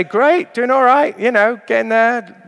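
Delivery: very high, loud, sing-song brush-off; mock cheery small talk. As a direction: parody the automatic "I'm fine" answer. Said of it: "whatever the usual nonsense".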